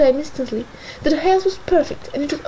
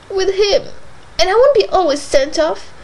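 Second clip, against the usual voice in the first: rising heat, louder; kindling up; 5 semitones higher, 4 dB louder than usual. A narrator's reading. Isabella kindling up against Cathy. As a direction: flare up at last. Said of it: "kindling up".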